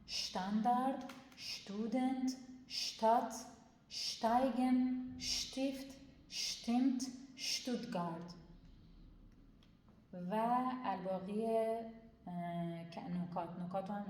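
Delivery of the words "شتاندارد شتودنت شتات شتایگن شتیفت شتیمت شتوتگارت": شتاندارد with a strong sh, words raised higher; firm sh demo. Shown as a demonstration. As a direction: lean on the sh in شتاندارد. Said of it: "ش محکمه".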